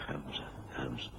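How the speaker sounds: urgent hushed whisper; nervous whispered question. Acted. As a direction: whisper a worried question in the dark. Hiding beside Holmes in dark.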